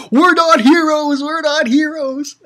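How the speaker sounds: high-pitched mock protest shout; imitating Luffy. Pirate protests to cheering crowd, high mock yell. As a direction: call it out high, in exasperated denial. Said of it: "again, he's like".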